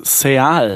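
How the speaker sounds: Seal said se-al, corrected. Shown as a demonstration. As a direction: Seal pronounced se-al. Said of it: "Nicht kannste".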